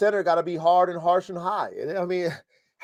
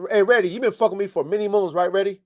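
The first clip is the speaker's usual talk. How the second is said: ordinary talk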